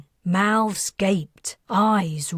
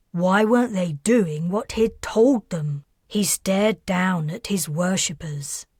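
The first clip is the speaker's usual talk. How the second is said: ordinary delivery, nothing marked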